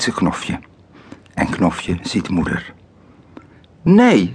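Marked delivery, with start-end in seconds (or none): 3.8-4.3 hoge kindergil; schreeuwend. A narrator's reading. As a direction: schreeuw het uit als kind. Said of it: "schreeuwt Knofje". (hoge, luide schreeuw)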